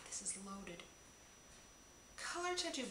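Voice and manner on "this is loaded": awed little whisper. Real, not acted. Marvelling at the loaded gift box, whispering. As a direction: breathe it out in awe.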